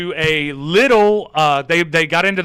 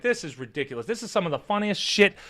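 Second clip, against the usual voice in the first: softer, chuckling quoted reaction; amused disbelief; 3 semitones lower, 7 dB quieter than usual. Retold or imitated. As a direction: quietly laugh through the words. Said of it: "I was like".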